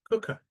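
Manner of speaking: Cooker with a high first syllable; Cooker, strong start, falling end; stress on first syllable. Shown as a demonstration. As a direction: punch the COOK in Cooker. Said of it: "First syllable".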